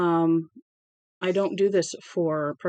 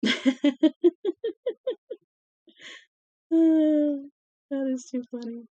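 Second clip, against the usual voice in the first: higher voice through real laughter; laughing; 8 semitones higher, slower than usual. Real, not acted. Reader cracking up mid-aside to listeners, laughing. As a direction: squeeze the words out through a giggle.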